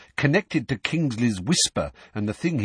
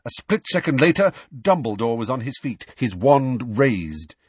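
plain reading voice

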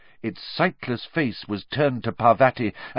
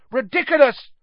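high, loud, forceful incantation; shout; 13 semitones higher, 6 dB louder than usual. A narrator's reading. Determined pupil charging at the boggart, shouted. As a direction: shout the spell with full force. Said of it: "he shouted".

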